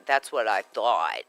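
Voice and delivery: low, slow imitated game character; video game voice. Co-host imitating the talking fish game, low drawl. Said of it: "that's what the video game".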